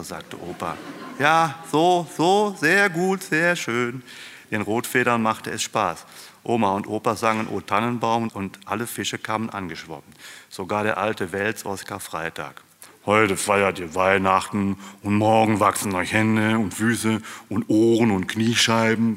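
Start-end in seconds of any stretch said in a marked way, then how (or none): 1.2-4.0 high, loud, drawn-out cheering on; encouraging praise. A narrator's reading. Opa directs little fish playing flames, encouraging. 13.1-19.2 louder, altered, grumbling character voice; grumbling old catfish. A narrator's reading. Old catfish grumbles into the mud, disapproving. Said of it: "brummelte er in den Morast".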